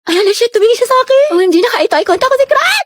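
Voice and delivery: high squeal, rising with excitement; kilig gushing. Acted. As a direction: gush that your crush looked at you. Girl gushes after crush looks. (kinikilig na pagtili)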